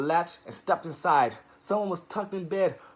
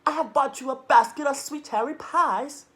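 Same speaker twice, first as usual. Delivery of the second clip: high, louder squeaky wolf; 8 semitones higher, 6 dB louder than usual. Acted. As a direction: squeak sweetly to sound harmless. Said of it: "in his squeakiest voice".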